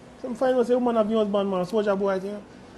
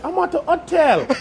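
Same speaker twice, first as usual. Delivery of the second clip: higher mock English girlfriend voice; lightly mocked English accent, higher than usual; imitated English accent; 8 semitones higher, 4 dB louder than usual. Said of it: "that England woman".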